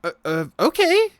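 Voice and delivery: put-on very high, loud, gleeful child; excited little girl. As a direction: squeal it like a delighted kid. Little girl answers happily.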